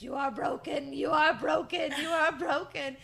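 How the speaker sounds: loud, high theatrical chant building in force; hammed-up taunting yell; imitating an in-your-face shout. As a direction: holler it like a crazed wrestler. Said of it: "gets on the floor in his face and says".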